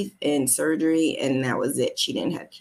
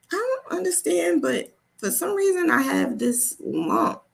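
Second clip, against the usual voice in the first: higher, puzzled telling; puzzled confession; 13 semitones higher than usual. Retold or imitated. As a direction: admit it, a bit bewildered. Retold telling mom figure.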